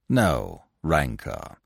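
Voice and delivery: "no rancour" said slowly and low, as a firm assurance; conciliatory. A narrator's reading. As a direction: offer peace slowly. Binet offering his hand.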